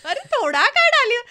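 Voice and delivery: hammed-up disbelief at the cook, high, loud and fast; exasperated retort.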